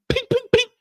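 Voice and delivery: very high, sharp ping sounds; high pinging gunfire noises; silenced gunshots. Retold or imitated. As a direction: mimic a silencer firing. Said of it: "That's the sign of the silencer".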